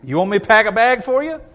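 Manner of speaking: dad teasing his runaway son. Retold or imitated. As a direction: call the kid's bluff cheerfully. Dad voiced dismissing son.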